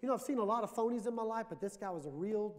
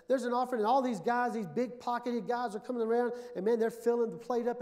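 preaching at full force; 4 semitones higher, 6 dB louder than usual. Acted. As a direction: build it into a thundering altar call. Preaching continues.

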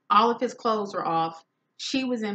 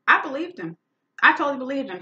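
higher and louder, insisting; emphatic; 7 semitones higher, 7 dB louder, faster than usual. Real, not acted. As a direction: stress that you truly believed. Insisting to the audience.

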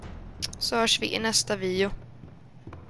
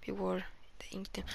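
fast, hushed whispered warning; spooky whisper; 3 semitones lower, 16 dB quieter, faster than usual. Shown as a demonstration. Player rereading the warning to viewers as a spooky whisper. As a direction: whisper it like a creepy secret. Reading the in-game objective.

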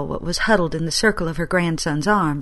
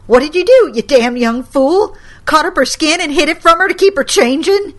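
high, loud furious rebuke; angry scolding; 8 semitones higher, 7 dB louder than usual. Acted. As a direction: scold the young fool. Grandma scolding.

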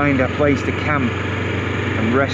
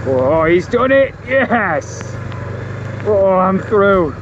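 excited cheering; 8 semitones higher, slower than usual. Real, not acted. Cheering as truck gets through.

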